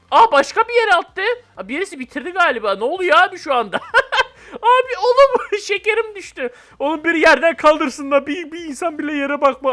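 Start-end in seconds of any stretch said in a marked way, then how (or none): 4.5-9.7 high, giggling through the words; laughing at own fall in the game. Real, not acted. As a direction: laugh while telling the fall. Laughing after falling.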